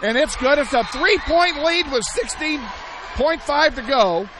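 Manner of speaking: loud, high, thrilled play-by-play call; excited shout. Real, not acted. Radio announcer calling the go-ahead free throw to fans, thrilled shout. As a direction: let the lead ring out.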